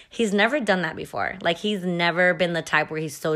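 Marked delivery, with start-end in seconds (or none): none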